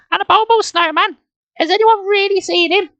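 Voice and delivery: put-on very high, squeaky girl voice; little sister. A narrator's reading. Nicole asking Dad about the snowman, high-voiced. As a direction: ask in a high-pitched sister voice.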